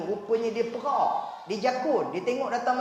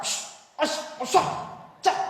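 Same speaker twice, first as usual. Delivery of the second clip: angry caretaker shouting; 9 semitones higher than usual. Retold or imitated. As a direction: mimic the old man scolding kids. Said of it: "masa pak cik tu marah, dia cakap". (tiruan pak cik marah budak)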